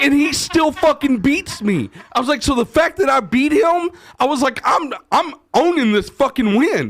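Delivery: high, excited retold rant; worked-up retelling. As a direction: rant it at your wife in a high pitch.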